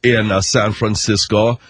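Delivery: put-on lazy German-accented pop star boasting. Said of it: "Like Milli Vanilli?".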